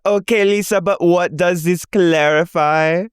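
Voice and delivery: high, slow parody of a castmate; imitating Whitney. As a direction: mimic her pleading, drawn out. Mimicking Whitney in recap.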